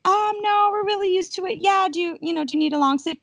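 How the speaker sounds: high, loud, sugary offer; overly nice offer of help; high sing-song mock politeness; polite host. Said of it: "I'm like".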